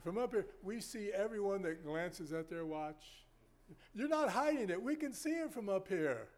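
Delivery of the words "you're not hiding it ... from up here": loud, high-pitched teasing scold; raised voice, catching them in the act; playful call-out. Real, not acted. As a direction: call them out from the pulpit, loudly. Playfully calling out watch-glancing congregation.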